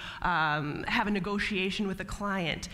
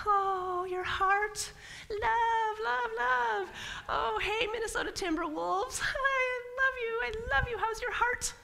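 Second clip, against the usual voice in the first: much higher, slow, gushing mock adoration; gushing love; 14 semitones higher, slower than usual. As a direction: coo it like you adore them. Gushing over heart chakra.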